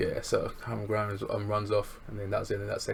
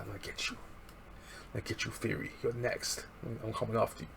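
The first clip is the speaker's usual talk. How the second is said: menacing whisper; 8 dB quieter than usual. Retold or imitated. Theory threatening Swerve in a soft, menacing whisper. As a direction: hiss a promise of revenge. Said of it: "he says to Swerve".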